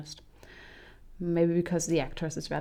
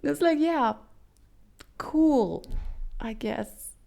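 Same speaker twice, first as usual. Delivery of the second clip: sarcastic cool; 7 semitones higher, slower than usual. Real, not acted. Sarcastic mock approval.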